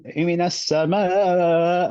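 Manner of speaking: mock overdone as-sama, dragged out; hammed-up slow as-sama; overdone nabr. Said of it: "this guy's reading beautifully".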